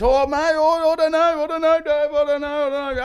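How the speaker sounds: very high, flustered denial; squeaky flustered player. Retold or imitated. Fibber dodging the coach's question, high flustered squeak. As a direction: squeak out the excuses. Fibber pleading ignorance, laughing.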